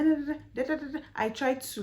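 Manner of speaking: ordinary delivery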